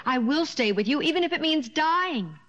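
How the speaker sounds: insist, pitch climbing to the end; insistent. Acted. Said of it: "But Peter insisted".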